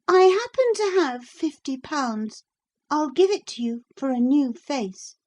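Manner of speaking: put-on high, eager girl's voice; princess bargaining. A narrator's reading. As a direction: offer the money brightly.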